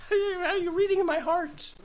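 mock spooked viewer. Spooked by preacher on screen.

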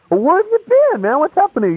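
very high, insistent mock questioning; cross-examining. Friends keep pressing him about his absence, high-pitched cross-examining. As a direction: imitate badgering friends, pitching way up. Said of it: "They kept cross-examining me".

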